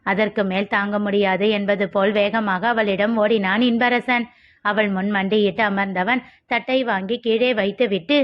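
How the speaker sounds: usual speaking voice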